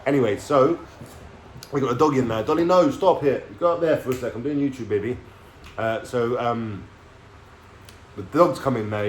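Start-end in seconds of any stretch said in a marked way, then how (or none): 2.4-4.3 scolding the dog. Real, not acted. Tells own dog off mid-video.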